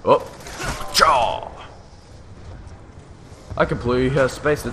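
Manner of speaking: ordinary talk, nothing marked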